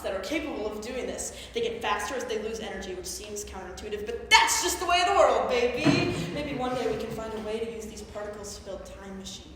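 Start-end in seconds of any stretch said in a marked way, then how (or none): 4.1-6.1 high, loud showy flourish; exuberant exclamation. Acted. Lecturer shrugging off odd physics to the audience, exuberant exclamation. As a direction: crow it to the crowd.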